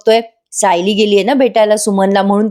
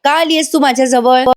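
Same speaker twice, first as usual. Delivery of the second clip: feigned madness; 8 semitones higher than usual. Acted. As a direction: fake a wild fit to fool him. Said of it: "नाटक करायला लागते लगेच वेडेपणाचं". (वेडेपणाचं नाटक करत ओरडणे)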